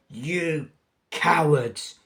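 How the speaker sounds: very slow, spat-out insult; spluttered insult. Acted. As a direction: drag out the contempt, sputtering. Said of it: "she spluttered".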